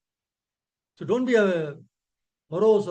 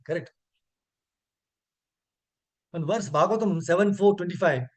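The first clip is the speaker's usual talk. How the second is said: normal talking voice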